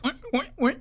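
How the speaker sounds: high oinking pig noise; mock pig oinking. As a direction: oink like a cartoon pig. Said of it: "a pig noise".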